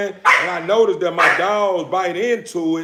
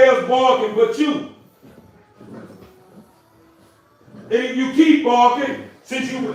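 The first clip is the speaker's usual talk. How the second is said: scolding the dog; 5 semitones higher than usual. Real, not acted.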